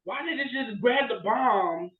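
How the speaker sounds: ordinary talk, nothing marked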